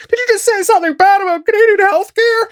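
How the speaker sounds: very high, squeaky mock-outraged voice; freaking-out Canadian. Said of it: "Anybody who is Canadian here is just freaking out".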